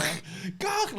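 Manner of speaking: hollering at a star; calling out. Retold or imitated. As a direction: call for attention. Calling out again, ignored.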